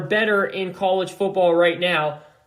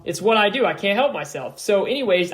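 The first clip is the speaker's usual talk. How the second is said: ordinary delivery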